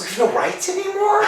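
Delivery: high, loud laughing disbelief; incredulous laughing. Acted. Told they've lost their rights, laughing high and loud. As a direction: laugh it out, shocked and amused.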